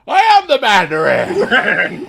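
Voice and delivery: slow, high, half-laughing mock cry; hammed-up shout. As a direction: bellow it out in overblown despair. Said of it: "and he's like".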